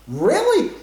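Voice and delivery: high-pitched surprised "Really?". Retold or imitated. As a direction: react in disbelief, pitch jumping up. Reacting to hearing a coincidence.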